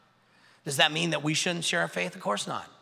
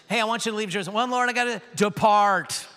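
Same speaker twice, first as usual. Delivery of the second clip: playful dialogue; 5 semitones higher, 5 dB louder than usual. Retold or imitated. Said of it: "but I read it like this".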